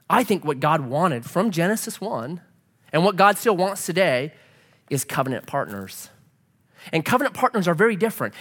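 ordinary delivery

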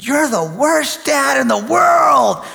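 high, drawn-out angry yell; wailing outburst at dad; retold yell. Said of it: "he yelled at me".

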